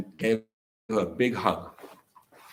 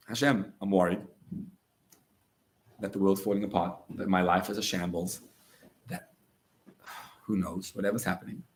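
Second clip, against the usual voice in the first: honest prayer; 6 semitones lower, 5 dB quieter than usual. Shown as a demonstration. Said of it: "ask Hashem to help you".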